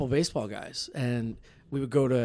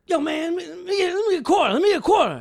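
high, fast street hustler voice, pitched way up; street guy asking; 7 dB louder, faster than usual. Retold or imitated. Telling friends about a mugger, high pushy street voice.

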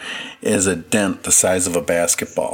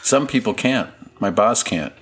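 usual speaking voice

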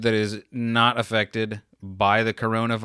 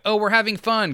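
put-on delighted kid; 10 semitones higher than usual. Kid realizing the ride is fun.

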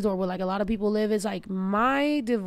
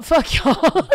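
laughing; 5 semitones higher, 7 dB louder than usual. Real, not acted. Laughing jab at chat.

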